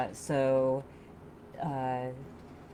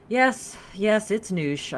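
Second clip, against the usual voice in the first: louder, higher, mildly exasperated assurance; patient assuring; 7 semitones higher, 7 dB louder, much slower than usual. A narrator's reading. Woman assuring the newcomer of her name, mildly exasperated. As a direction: assure her while holding back a sigh. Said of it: "I assured her, trying not to sigh".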